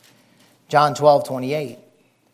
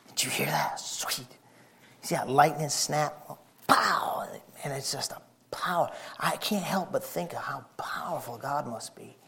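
whisper; 9 dB quieter than usual. Acted. Hushed awe describing storm.

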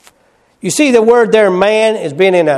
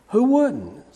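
quieter, drier repeat of "Who wouldn't?"; softer echo; 7 semitones higher, 6 dB quieter than usual. Real, not acted. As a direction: let "Who wouldn't?" fall away softly. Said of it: "repeats it softer".

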